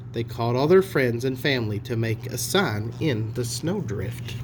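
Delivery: ordinary delivery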